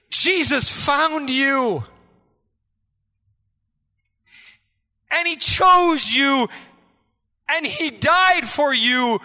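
slow, loud, emphatic preaching; preaching at full force. Acted. Preacher proclaiming to the congregation, full force. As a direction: hammer each phrase home.

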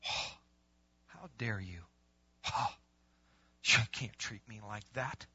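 soft, low mock outrage; affronted gasp. Churchgoer refused by the pastor, affronted mock outrage. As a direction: act wounded and scandalized, hushed.

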